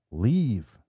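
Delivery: mock yell to cast something out; mild retold scream. Possessed boy defies the demon inside him, mild retold scream.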